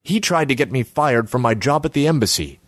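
accusing. A narrator's reading. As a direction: lodge an indignant complaint. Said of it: "Ace accused him".

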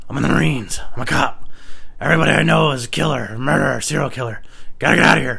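fast, loud, panicky rant; frantic bluffing. Retold or imitated. Scaring off kid with a gun.

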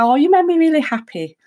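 acted delight. Telling a partner they did something lovely, acted delight. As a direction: put on a happy gush, pitched well up.

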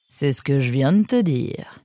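ordinary delivery, nothing marked

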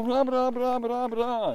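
high mock gibberish chatter; imitating a language you can't follow; mock foreign babble. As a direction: babble nonsense words up high.

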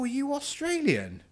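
very high-pitched imitated stranger; curious American questioner. American at work asking about his accent, curious. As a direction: ask it high and innocently curious.

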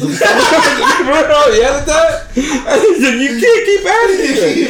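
high, slow words squeezed out through laughter; can hardly speak for laughing; laughing while retelling. Real, not acted.

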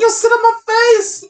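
put-on loud, high drunken holler; drunk shout. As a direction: yell it across the room.

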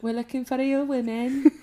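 put-on a half-hearted stab at a Liverpool accent; a sketchy Liverpool accent; attempted Scouse accent. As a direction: try Colleen's Scouse voice and fall short. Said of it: "No, I can't do it".

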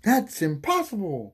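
high, drawn-out exclamation of disbelief; mock outburst. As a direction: blurt it high and slow, hammed-up. Repeats line in exaggerated voice.

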